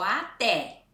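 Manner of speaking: até with the final TÉ stressed. Shown as a demonstration. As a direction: lean on the last syllable of até. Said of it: "que é a mais forte".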